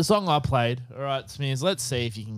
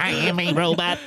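robot voice; 3 semitones higher than usual. Retold or imitated. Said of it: "Mister Robot walked in".

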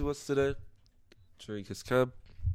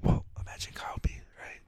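soft conspiratorial whisper; 8 dB quieter than usual. Real, not acted. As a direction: whisper like sharing gossip. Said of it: "Why are we whispering?".

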